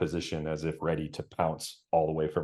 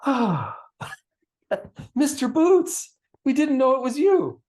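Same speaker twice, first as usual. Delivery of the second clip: much higher, loud, laughing cat-woman greeting; delighted tabaxi; 8 dB louder than usual. Acted. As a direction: burst into a surprised delighted welcome. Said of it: "she's like".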